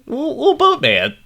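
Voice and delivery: high-pitched mocking voice. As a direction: squeak it out in a silly falsetto. Mocking [name]'s persona.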